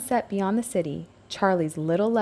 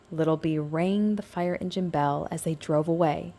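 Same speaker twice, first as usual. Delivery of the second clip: ordinary delivery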